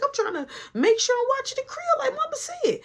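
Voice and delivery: very high falsetto voice; mock high-pitched whine; imitated friend's excuse. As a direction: squeak out a defensive excuse as the friend.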